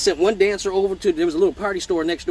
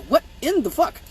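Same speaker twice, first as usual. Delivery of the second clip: shocked what; 8 semitones higher than usual. Retold or imitated. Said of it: "So I'm like".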